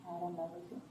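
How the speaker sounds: barely audible, mumbled denial; quiet denial. Real, not acted. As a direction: say it under your breath. Suspect denying under questioning.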